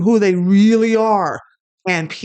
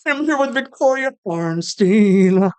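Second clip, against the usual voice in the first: slow diva impression, pitched up; 4 semitones higher, slower than usual. Shown as a demonstration. Comic doing a requested impression for the host, drawn-out diva voice. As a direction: stretch the name out like a pop diva. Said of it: "do a [name] impression".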